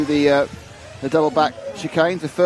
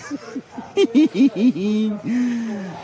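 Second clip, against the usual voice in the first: giggling laugh; 7 semitones higher than usual. Real, not acted. Laughing at being naughty.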